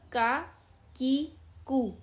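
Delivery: "کا", "کی", "کو" with a plain k, one by one; unspelled syllable drill. Shown as a demonstration. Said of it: "جوڑ کر کے پڑھنے کی ضرورت نہیں ہے".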